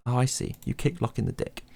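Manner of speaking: usual speaking voice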